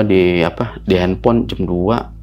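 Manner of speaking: normal talking voice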